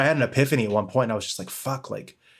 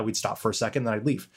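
usual speaking voice

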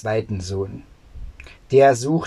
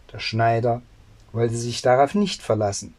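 ordinary delivery

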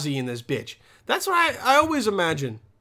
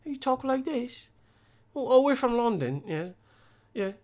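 higher-pitched imitation English accent; mock English accent; 5 semitones higher, 4 dB quieter than usual. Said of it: "her English accent was just exactly like mine".

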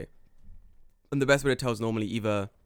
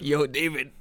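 mock panic; 4 semitones higher, 7 dB louder than usual. Retold panicked message to cohost.

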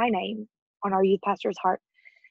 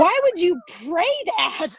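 high, loud, disbelieving outburst; incredulous why; 9 semitones higher, 4 dB louder than usual. Retold or imitated. Retelling her shocked reply to her father-in-law, high incredulous cry. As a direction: throw the question back in shock.

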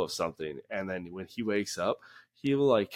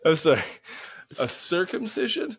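much higher and louder, astonished; real disbelief; 9 semitones higher, 4 dB louder than usual. Real, not acted. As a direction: react like you misheard something absurd. Shocked by co-host's news.

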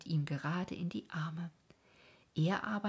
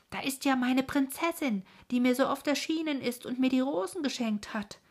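much higher, louder, overjoyed; spotting his princess at last; delighted cry; 8 semitones higher, 6 dB louder than usual. Acted. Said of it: "rief Traumjörg ganz entzückt aus".

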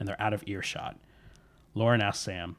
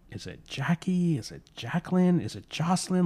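higher-pitched, searching questions; puzzled guessing; 9 semitones higher than usual. Acted. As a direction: try out each name as a question. Sam guessing at the spirit's name.

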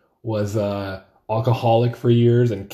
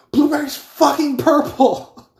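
much higher, slow, outraged puppet cry; imitating Randy the puppet; 5 dB louder, slower than usual. Puppet comedian snapping back at the crowd, high mock outrage. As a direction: mimic the Australian puppet losing it over blueberries. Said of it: "it's just an accent and the animatedness of his Muppet".

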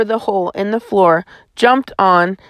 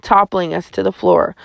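ordinary delivery

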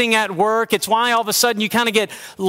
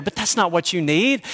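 ordinary talk

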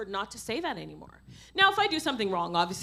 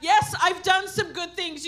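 raised, higher sermon voice; forceful preaching; 5 semitones higher, 4 dB louder than usual. Real, not acted. Preacher urging an apologetic church to wake up, forcefully. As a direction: challenge the congregation with punched, ringing words.

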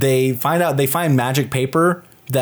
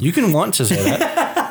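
high, laughing teasing retort; laughing tease; 7 semitones higher than usual. Real, not acted. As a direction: tease him through a laugh.

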